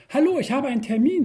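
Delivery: high, bright and quick greeting; cheerful. Acted. Tipsy newcomer greeting the counselor, cheerful and quick. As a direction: burst in cheerfully, a little tipsy. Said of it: "sage ich fröhlich".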